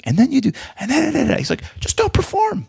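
mocking bouncer.

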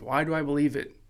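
ordinary delivery

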